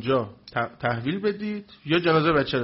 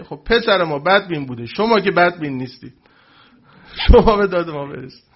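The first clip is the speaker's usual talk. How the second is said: louder, desperate plea; mild mock desperate pleading; pleading mother; 7 dB louder than usual. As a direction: beg the cleric for help, voice rising.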